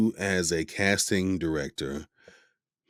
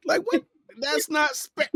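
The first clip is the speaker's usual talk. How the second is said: mock disbelief; 5 dB louder than usual. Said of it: "I said".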